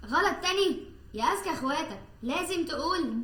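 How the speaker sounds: exasperated. Acted. Exasperated at repeated mistake.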